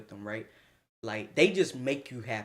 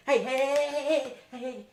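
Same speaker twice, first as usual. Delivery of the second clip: calling out; 9 semitones higher than usual. Retold or imitated. Friend calling to interrupt.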